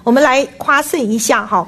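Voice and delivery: normal talking voice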